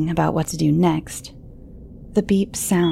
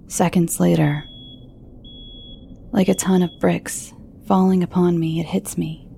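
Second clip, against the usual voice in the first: plain reading voice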